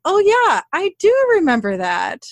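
uncle finally admitting. Retold or imitated. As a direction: play it as a sudden, gleeful admission. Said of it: "her dad, was finally like".

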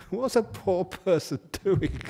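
words breaking into laughter; laughing through it. Real, not acted. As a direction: chuckle through the joke. Joking while laughing at own story.

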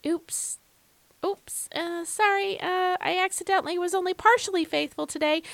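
high, squeaky fake voice; mock flustered apology. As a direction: play a sheepish bumbler caught out. Mocking an unfaithful God.

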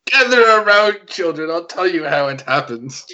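put-on gather the kids round like grandpa; rambling grandpa story. Takes over the story mockingly.

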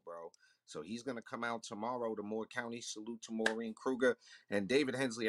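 ordinary talk

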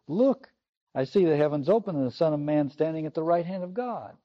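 pleading with them to see the vision; urgent call. Acted. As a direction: Stephen trying to convert his killers, with urgency. Stephen urging his killers to see.

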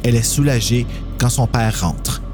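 usual speaking voice

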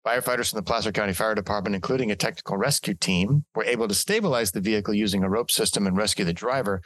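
ordinary talk, nothing marked

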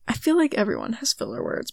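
ordinary talk, nothing marked

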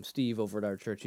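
ordinary delivery

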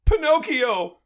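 high, loud cry of recognition; joyful shout. Acted. Old man spots his long-lost son, shouts his name in delight. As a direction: bellow the name like a father spotting his lost boy.

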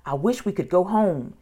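plain reading voice

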